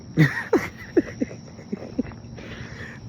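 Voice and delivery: high airy giggle; breathy laugh. Real, not acted. Laughing at his own singing, a high airless giggle. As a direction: let a laugh burst out.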